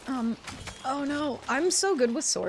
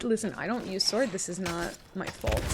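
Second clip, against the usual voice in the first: ordinary talk, nothing marked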